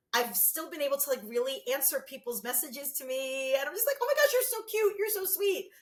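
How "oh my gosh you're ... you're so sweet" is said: high cooing gush. Retold or imitated. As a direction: coo it like answering a sweet fan. Replying to fans' messages.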